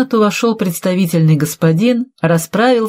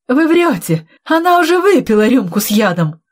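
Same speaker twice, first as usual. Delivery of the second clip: high-pitched accusing outcry; panicked denial; 7 semitones higher than usual. Acted. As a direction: blurt it out in disbelief. Cornered villain cries out accusing. (возмущённо, не веря)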